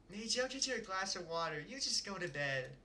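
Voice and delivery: lightly voiced caring mother; a gentle mom sending her kid back to bed; settled mom voice. Shown as a demonstration. Reader settles into the mom voice for the line. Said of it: "trying to perfect this voice".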